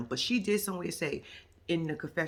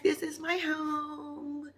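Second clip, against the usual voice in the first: drawn-out high weepy mock; high, slow mock sob; slow high crying voice; 8 semitones higher, much slower than usual. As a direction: imitate her teary pride, slowly. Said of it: "She was like".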